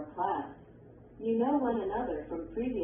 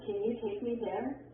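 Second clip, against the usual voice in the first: yes/no question rising on "there"; rising intonation; 2 semitones higher than usual. Shown as a demonstration. Textbook example of a yes/no question, rising intonation. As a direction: let the voice go up at the end on "there". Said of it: "arrows that show rising intonation".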